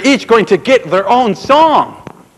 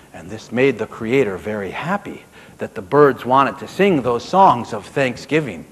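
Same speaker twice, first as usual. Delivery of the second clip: ordinary delivery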